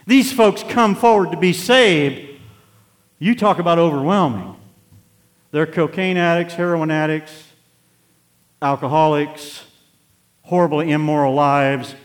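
loud, high, driving sermon voice; full-force preaching. Acted. Preacher rousing the congregation, loud full-force preaching. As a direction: preach it hard to the back pews.

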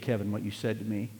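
normal talking voice

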